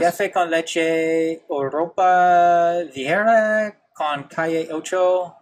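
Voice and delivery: slow, deliberate attempt at a Spanish accent; laboured, over-careful Spanish accent. Shown as a demonstration. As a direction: draw out the Spanish words without rolled R's. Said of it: "my Spanish accent".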